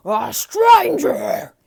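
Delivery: very loud, slow roar. A narrator's reading. Beast roaring at the intruder, very loud and slow. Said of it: "he roared".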